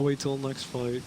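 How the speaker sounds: put-on sad boy voice. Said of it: "my sad boy voice".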